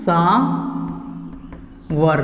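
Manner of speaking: "सावर" very slowly, "saa... war"; long pause inside "सावर" after a held "saa"; syllable-by-syllable pronunciation. Shown as a demonstration. Teacher drilling what to always say, slow split "सावर". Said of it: "इसको बोलना है हमेशा".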